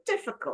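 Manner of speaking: "difficult" starting high on DIF, then dropping; stress on first syllable. Shown as a demonstration. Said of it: "The first syllable".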